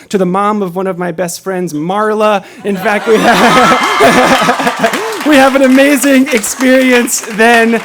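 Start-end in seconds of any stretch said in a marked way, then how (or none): 2.7-7.8 bursting into loud laughter mid-sentence; words stretch and break up into a high, loud laugh; real laughter. Real, not acted. Storyteller recalling times with his friends, cracking up.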